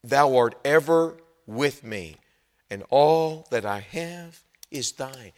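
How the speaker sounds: slow, tender father, lightly voiced. Father speaks tenderly to his angry son. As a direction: say it gently to your boy. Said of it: "Tenderly, he says".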